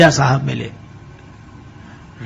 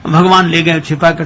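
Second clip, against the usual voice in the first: usual speaking voice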